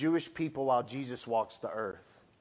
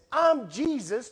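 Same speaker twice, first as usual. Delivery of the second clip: proclaiming; 7 semitones higher, 6 dB louder than usual. Retold or imitated. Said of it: "And the voice says".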